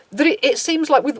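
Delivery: normal talking voice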